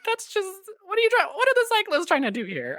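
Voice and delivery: high-pitched baffled protest; mock bafflement. Acted baffled reaction to film.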